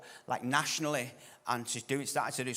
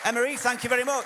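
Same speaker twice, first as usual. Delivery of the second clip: high, loud rousing introduction; big loud thank-you to bring on a guest; calling up the speaker; 10 semitones higher, 8 dB louder than usual. Real, not acted. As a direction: raise the voice over the applause to welcome them on. Host calls speaker up to applause.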